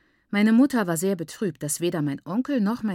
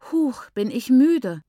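louder, higher and slow, panting it out; tired child's panting; 6 semitones higher, 6 dB louder, slower than usual. A narrator's reading. Little girl complaining she is tired after carrying bread, slow and panting. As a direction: puff the words like a worn-out little girl. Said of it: "keuchte Rambanai".

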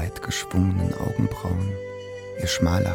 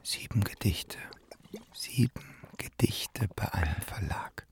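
soft, half-whispered self-talk; quiet, breath-heavy repetition; hushed musing; 7 semitones lower, 7 dB quieter than usual. Acted. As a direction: murmur it to yourself. Muttering to himself about poems.

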